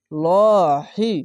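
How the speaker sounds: লহি held very long and loud with a heavy L; heavy Allah sound. Shown as a demonstration. Teacher showing the heavy L of Allah, loud and drawn out. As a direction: stretch লহি out with a thick, full L. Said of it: "মোটা করে পড়বেন".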